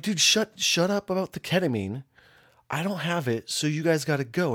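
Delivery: high, urgent retold telling-off; exasperated demand. Host ordering loud visitors to leave, exasperated.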